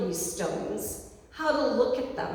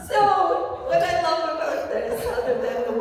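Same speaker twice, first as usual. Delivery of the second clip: laughing; 6 semitones higher, 5 dB louder than usual. Real, not acted. Still laughing after the joke.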